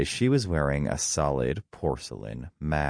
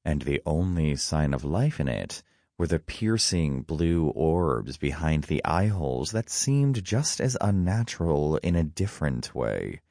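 ordinary delivery

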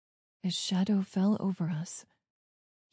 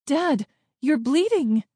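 high, loud exclamation; alarmed cry; 7 semitones higher, 5 dB louder than usual. A narrator's reading. Child seeing blood on Dad's sleeve, alarmed cry.

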